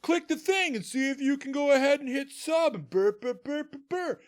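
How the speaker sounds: high-pitched mock streamer plug; squeaky sales patter trailing into babble; pushy streamer. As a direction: parody a streamer begging for subs. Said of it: "a streamer will be like".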